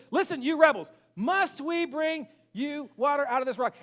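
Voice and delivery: high, loud, acted indignation; mad rebuke. Moses rebuking the thirsty assembly, mad rebuke. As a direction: call it out slowly, pitched high, like mock fury. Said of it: "but he's mad".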